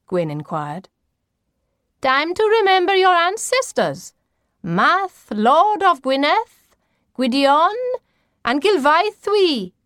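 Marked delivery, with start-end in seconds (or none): 2.0-9.7 high, loud, incantatory roll-call; portentous grandmother. A narrator's reading. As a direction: proclaim the ancestral names grandly as the grandmother. Voices the grandmother in the story.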